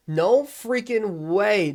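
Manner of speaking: slow, incredulous protest; real disbelief. Real, not acted. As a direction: stretch each word in disbelief. Opponent scores a touchdown.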